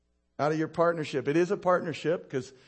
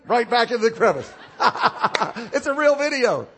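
high, loud talk broken by laughter; can't stop laughing at it; 7 semitones higher, 9 dB louder than usual. Real, not acted. Preacher tells the congregation about a viral video, laughing.

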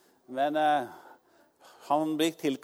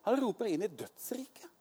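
high hushed whisper; whispered with awe; 8 semitones higher, 4 dB quieter than usual. Acted. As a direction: breathe it out, no voice. Sermon climax whispered.

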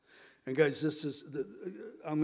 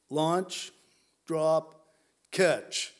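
each word hit loud; punchy commands; 6 dB louder, much slower than usual. Acted. Jesus commanding disciples, clipped and loud. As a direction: bark out three commands.

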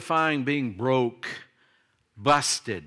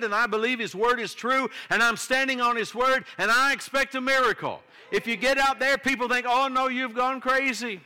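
fiery preaching; 7 semitones higher than usual. Acted. Preaching at full force.

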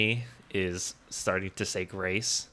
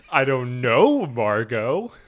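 mock shouted reply; 3 semitones higher, 6 dB louder, much slower than usual. Quoting Todd shouting back.

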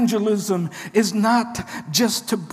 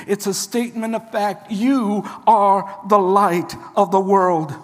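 usual speaking voice

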